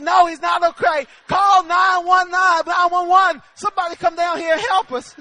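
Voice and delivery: loud, hammed-up cry for help; panicked shouting, played for laughs; retold panic. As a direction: call out in comic alarm. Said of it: "I'm starting to panic".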